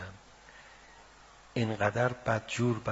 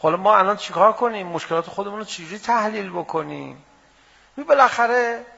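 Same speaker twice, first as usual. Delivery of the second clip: loud, rising rhetorical preaching; fiery pulpit challenge, much louder; preaching at full force; 6 semitones higher, 10 dB louder than usual. Acted. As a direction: hammer each question at the crowd. Heated back-and-forth with objectors.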